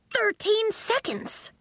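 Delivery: very high, slow exclamation; amazed delight. A narrator's reading. Coach reads the stopwatch to Nori, amazed. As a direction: announce the time high and stretched out.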